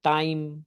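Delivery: "टाइम।" with the a-to-i glide; pronunciation demo. Shown as a demonstration. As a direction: show "टाइम।" slowly to the class. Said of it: "इसको ऐसे पढ़ रहे हैं हम". ("टाइम।" को ए-आई glide से)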